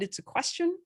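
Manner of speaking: "question" rising sharply at the end; question intonation. Shown as a demonstration. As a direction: lift "question" high, as if asking. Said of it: "if your intonation goes up at the end".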